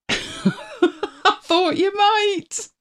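said through a high laugh. Real, not acted. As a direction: giggle the words out, teasing. Laughing at a viewer.

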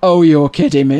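loud amazed exclamation; a friend reacting loudly to gossip; retold surprise. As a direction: blurt it out in disbelief. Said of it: "he went".

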